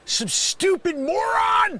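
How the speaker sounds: high, slow, drawn-out yell; slow-building comic fury; furious insult. Acted. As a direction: stretch the insult out in exasperation. Insulting the caller at peak.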